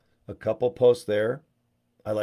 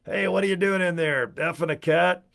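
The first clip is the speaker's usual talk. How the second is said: higher, pushier voice of a nosy guest; hotel guy; 8 semitones higher than usual. Retold or imitated. Nosy guy knocking on the hotel door, higher and pushy. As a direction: bang on the door and needle him. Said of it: "the guy's, like".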